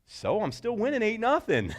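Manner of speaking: high, smug retort; rival coach smug. Retold or imitated. Rival coach repeating the score to her, smug. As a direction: rub in the score. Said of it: "rival coach replies".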